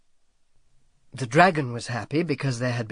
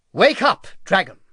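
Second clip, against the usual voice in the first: sharp, louder and higher command; 6 semitones higher, 6 dB louder than usual. A narrator's reading. Saint George rouses the sleeping dragon, sharp. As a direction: snap the dragon awake. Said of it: "he said sharply".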